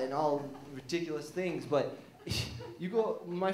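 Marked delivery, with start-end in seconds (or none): none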